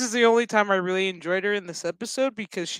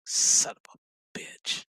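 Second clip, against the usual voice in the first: muttered curse; slower than usual. Retold or imitated. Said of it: "And he's just like".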